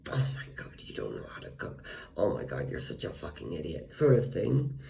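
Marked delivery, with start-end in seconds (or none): 0.1-1.8 breathy mocking whisper, a bit fast. Neighbor sneering at her cooking, in a mocking whisper. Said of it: "she would say, like". 2.2-3.9 lower, quiet sneering put-down; mocking contempt. Neighbor belittling her, low and sneering. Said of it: "she would say, like".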